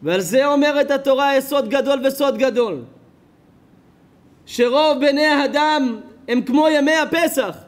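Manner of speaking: fiery sermon climax; preaching at full force. Acted. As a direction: thunder it from the pulpit.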